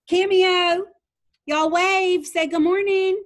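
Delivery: loud cheerful call to the class. Real, not acted. Teacher calling class to greet.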